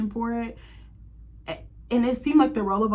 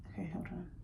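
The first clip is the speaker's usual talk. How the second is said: whisper to self; 2 semitones lower, 16 dB quieter than usual. Real, not acted. Muttering to herself while searching.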